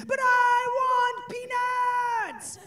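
high, slow crying wail; toddler crying. Retold or imitated. Toddler cries for peanuts, high slow sobbing wail. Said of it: "now he's screaming".

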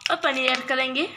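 ordinary talk, nothing marked

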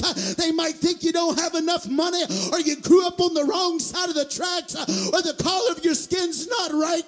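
shouted, high-pitched rhythmic preaching; full-force preaching. Real, not acted. Preacher assuring the congregation God looks past the outside, full-force preaching. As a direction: build to a loud, driving peak with gasped beats between phrases.

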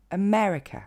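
America avec ME accentué, voyelles voisines brèves; prononciation anglaise. Shown as a demonstration. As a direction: dis America à l'anglaise : e-ME-re-que. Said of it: "l'accent tombe sur le mais". (America en anglais, finale réduite)

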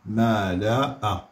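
ملأ drawn out slowly; slow model word. Shown as a demonstration. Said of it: "هنا ملأ".

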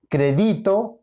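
"crédito" stressed as cre-DI-to, high and left rising; stress on middle syllable. Shown as a demonstration. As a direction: lift the DI of "crédito". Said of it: "No, es crédito". («crédito» con acento en DI: cre-DÍ-to)

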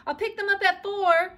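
high, loud cheery call; gaily. A narrator's reading. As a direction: call out happily as you leave. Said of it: "she said gaily".

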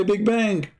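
mocking quote. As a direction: toss off their catchphrase with scorn, up high. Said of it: "they say".

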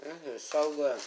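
chewing while talking; mouth full. Real, not acted. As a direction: talk through a mouthful. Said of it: "talking with food in my mouth".

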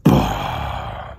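low, loud "Bomb!" call; loud cast shout. Pretend. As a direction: yell it as you let the cast fly. Announces big cast.

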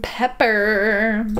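"Pepper" drawn out slowly on the final r; second R pronounced. Shown as a demonstration. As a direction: linger on the r ending "Pepper". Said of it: "you pronounce the second R".

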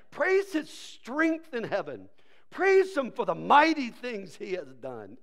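proclaimed slowly, 'mighty' punched loud; slow psalm reading with a heavy 'mighty'; stress on mighty. Shown as a demonstration. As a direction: lean hard on the word 'mighty'. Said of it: "Emphasis on mighty".

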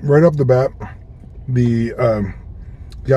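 chewing while talking; muffled food in mouth; mouth full. Real, not acted. Seen 1: bites burrito at -1.0, then talks with lips tight, looking down.

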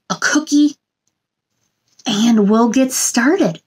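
usual speaking voice

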